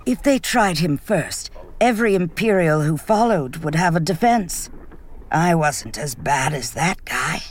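a lightly voiced soldier, sneering at the trial idea; scoffing Vitali. Vitali to the table after spitting, lightly voiced scoffing.

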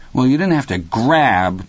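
lightly voiced sulky son; aggrieved protest. A narrator's reading. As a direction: object like a kid who had a toy snatched. Son protests after father snatched toy.

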